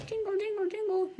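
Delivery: high-pitched jingly voice; mock jingling sound. Shown as a demonstration. Teacher joking about a falling pumpkin's sound, high jingling. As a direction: chime the word like tiny bells. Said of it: "I don't think a pumpkin goes".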